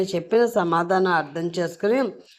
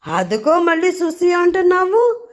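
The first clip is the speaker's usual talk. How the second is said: indignant; 10 semitones higher than usual. A narrator's reading. Drunk wife snapping at husband.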